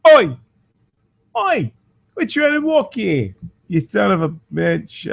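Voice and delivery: put-on Oi at the drivers in a garbled London-ish accent; naked man shouting at cars in a confused cockney-ish accent; muddled cockney-ish accent. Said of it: "can't even figure out what accent that was supposed to be".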